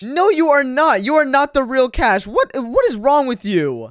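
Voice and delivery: heated, exasperated denial; indignant protest. Acted. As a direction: angrily contradict an impostor. Arguing with the impostor.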